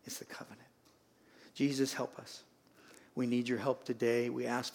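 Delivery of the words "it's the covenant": soft whispered emphasis. Acted. Pastor whispering the key point to the church. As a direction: breathe it out. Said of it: "sermon climax whisper".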